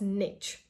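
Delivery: niche said to rhyme with "itch"; niche as "nitch"; common mispronunciation. Retold or imitated. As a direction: say niche with a hard "tch" ending. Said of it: "many students pronounced this word".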